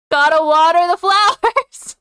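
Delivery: loud, high playful cheer; excited shout. Real, not acted. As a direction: call it out with glee.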